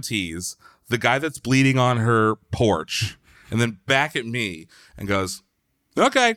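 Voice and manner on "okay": high, louder resigned "Okay"; a sudden high-pitched "Okay" of giving up; deadpan acceptance. Retold or imitated. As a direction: shrug it off and head back to bed. Matriarch surveys scene, goes to bed.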